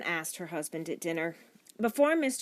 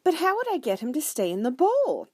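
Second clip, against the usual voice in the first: high, raised, eager question; excited; 10 semitones higher, 6 dB louder than usual. A narrator's reading. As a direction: ask keenly, carried away by the idea. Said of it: "said Missus Franklin excitedly".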